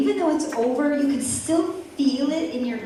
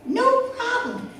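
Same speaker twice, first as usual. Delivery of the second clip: "no problem" much higher, eager and bouncy; bright eager repeat; 10 semitones higher than usual. Retold or imitated. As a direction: lift it high to sell the offer. Mom's repeated reassurance.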